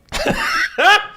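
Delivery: squealing embarrassed laugh; high burst of laughter. Real, not acted. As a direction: crack up reading the line. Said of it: "This is crazy".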